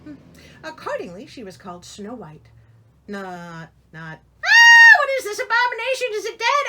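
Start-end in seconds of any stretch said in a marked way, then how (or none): 4.4-6.7 shrill, piercing shout of disgust at a newborn; horrified shriek. Pretend. Reader mocks a parent's horror at the baby, shrieking. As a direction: scream it like something ghastly just crawled in.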